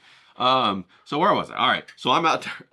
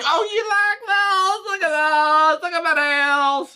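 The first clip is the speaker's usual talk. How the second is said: very high, loud, slow sing-song squeal; gushing Karen; 6 dB louder, slower than usual. Retold or imitated. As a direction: show off your nails, squealing. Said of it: "he says".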